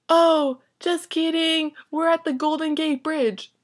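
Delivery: high put-on voice, loud opening; mock a breezy friend, pitched well up; Christy. Friend revealing she is somewhere else entirely, high mock voice. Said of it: "And she's like".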